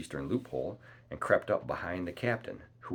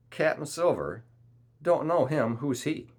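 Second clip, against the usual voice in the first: high, loud, incredulous questioning; crying out; 8 semitones higher, 7 dB louder than usual. A narrator's reading. Captain disowns the title Captain Silver, crying out. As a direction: cry it back as a mocking question. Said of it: "cried the captain".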